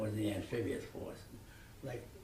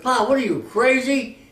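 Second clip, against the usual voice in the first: 1 incredulous protest; 18 dB louder than usual. Retold or imitated. Son protests father's dangerous idea.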